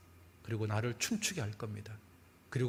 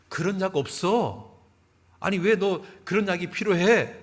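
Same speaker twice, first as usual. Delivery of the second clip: loud, slow, higher pharmacist voice; gruff pharmacist; 6 semitones higher, 9 dB louder, slower than usual. Retold or imitated. Pharmacist questioning the girl, loud and slow. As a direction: boom it out slowly like a puzzled shopkeeper.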